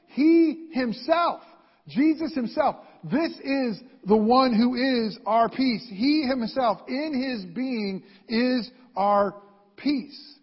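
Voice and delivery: loud, high, heavy stress on "He himself"; emphatic. Retold or imitated. Preacher quoting scripture to the congregation, emphatic. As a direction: punch each phrase slowly and forcefully. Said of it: "it says it emphatically".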